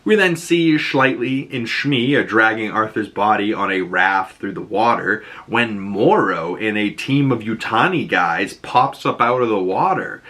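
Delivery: normal talking voice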